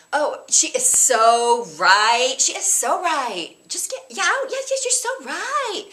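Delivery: put-on high, slow, loud valley girl. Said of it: "they used to say".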